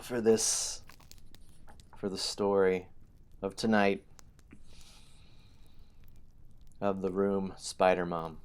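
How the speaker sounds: spooky storyteller gathering listeners; horror host. Acted. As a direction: invite them in like a horror host. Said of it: "I need to read our story".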